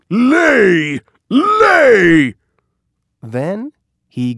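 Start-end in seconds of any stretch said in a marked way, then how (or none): 0.1-2.3 high, loud crying-out giant voice; dopey cartoon bellow. A narrator's reading. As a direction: cry it out like a greedy giant. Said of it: "says the giant".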